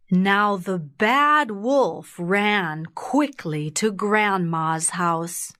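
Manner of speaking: usual speaking voice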